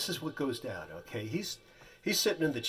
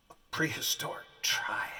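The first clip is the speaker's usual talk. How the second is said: mock-tearful director dropping to a whisper; whispered direction. Weeping director coaching actor, sudden whisper. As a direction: breathe it like a secret. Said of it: "He goes".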